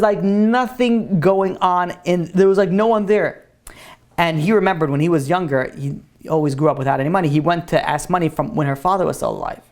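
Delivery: usual speaking voice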